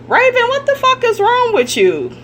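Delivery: scolding a celebrity she thinks is foolish, exasperated; exasperated outburst. Real, not acted. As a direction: cry out at her in disbelief. Outraged call-out at Raven.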